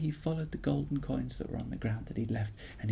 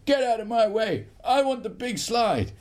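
loud, higher eager demand; bold boy; 8 semitones higher, 6 dB louder than usual. Acted. Brave boy demanding the slide, loud eager shout. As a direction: push past boldly and loud. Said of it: "the boy said".

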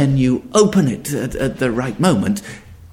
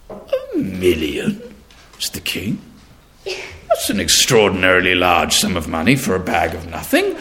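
astonished king; 8 semitones lower than usual. Acted.

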